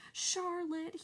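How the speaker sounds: high and drawn out, grieving; moaning. A narrator's reading. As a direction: moan her name in sorrow. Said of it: "he moaned".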